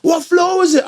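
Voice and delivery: high, loud, slow demand; tough demand. Retold or imitated. The buyer to the narrator, loud and slow. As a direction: press him for the floor. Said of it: "this guy was like".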